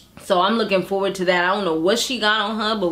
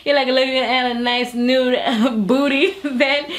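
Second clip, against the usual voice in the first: laughing; 4 semitones higher than usual. Real, not acted. Gushing over strip club dancers.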